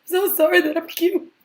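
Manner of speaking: high, loud, whiny fake-sorry voice; hammed-up Karen tears; mock tearful apology. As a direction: ham up a fake weepy apology. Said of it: "cried fake tears".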